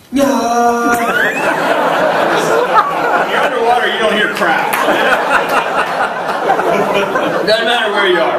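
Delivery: laughing at his own crowd joke. Real, not acted. Swimmer laughing while telling audience about the race.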